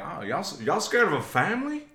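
Winston Duke impression. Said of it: "I like your impression".

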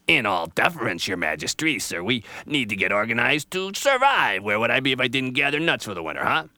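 loud, busy know-it-all squirrel; a fussy squirrel lecturing; bossy squirrel voice. Acted. Squirrel lectures Jaybird on organization, loud and bossy.

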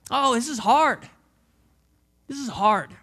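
ordinary talk

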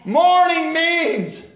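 high, ringing preacher's cry; exultant proclamation. Real, not acted. Preacher proclaiming what morning means, exultant cry. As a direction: soar up and proclaim it.

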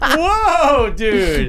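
high, loud whoa with laughter; mock-shocked whoa. Real, not acted. Reacting to friend's jab, loud high laughing shock.

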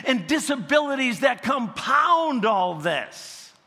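high, loud preaching, nearly shouting; fiery preacher voice; preaching at full force. Acted. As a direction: drive it home to the congregation.

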